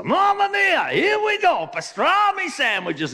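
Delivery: very high, loud Italian outburst; over-the-top Italian-accented shout; hammed-up Italian worker. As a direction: explode like an exasperated Italian. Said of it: "Italian opened his lunchbox".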